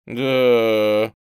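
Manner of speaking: loud, drawn-out doubtful yeah; worried, distrustful drawl. A narrator's reading. Skinny boy doubting the promises, distrustful drawl. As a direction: stretch it out sceptically. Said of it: "озабоченно недоверчиво протянул".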